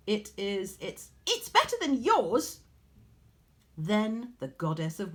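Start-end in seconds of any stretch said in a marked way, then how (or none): 0.1-2.6 much higher, louder gloating boast; smug boast. A narrator's reading. Mortal weaver boasting to Athene, high gloating. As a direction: stumble then crow it out. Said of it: "Arachne said smugly".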